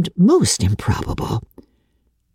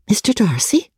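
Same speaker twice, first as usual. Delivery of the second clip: amazed; 7 semitones higher than usual. Acted. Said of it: "in utter amazement".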